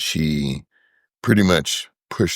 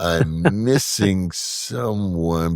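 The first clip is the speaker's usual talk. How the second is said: slow, drawn-out pondering; very deliberate, in no hurry; speaking really slowly; much slower than usual. Real, not acted. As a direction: stretch the words while searching memory. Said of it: "I'm speaking really slowly".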